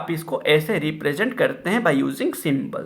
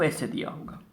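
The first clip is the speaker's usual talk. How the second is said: whisper; 4 semitones lower, 11 dB quieter than usual. Real, not acted. Teacher murmurs while writing.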